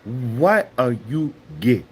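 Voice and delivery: mock African accent, emphatic rhythm; hammed-up African accent with swinging pitch; Chaba. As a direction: put on a deliberate African accent. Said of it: "I talk like this".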